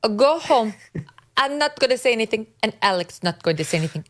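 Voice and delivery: high, mocking put-on Spanish accent; [name]'s fake Spanish accent. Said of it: "fake cosplaying as a Spaniard".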